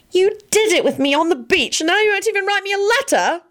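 high-pitched hurt wail; plaintive, soaring complaint. A narrator's reading. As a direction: wail it like a wronged girl. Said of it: "Elizabeth wailed".

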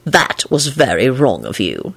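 lightly voiced Lupin, amused and pleased; a hint of a gleeful scold; delighted. A narrator's reading. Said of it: "he said delightedly".

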